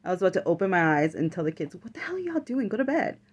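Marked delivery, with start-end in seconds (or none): 1.7-3.2 higher-pitched exasperated mom scold; mock scolding. A tired mom scolds her sons at night, exasperated. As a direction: snap at the kids to get to bed.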